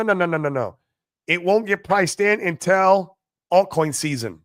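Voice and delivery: normal talking voice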